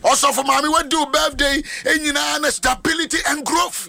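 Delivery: high, ringing preacher's proclamation; prophetic blessing. Real, not acted. Pastor blessing the counselor's oil trade, fervent prophetic declaration. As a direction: proclaim it high over each member.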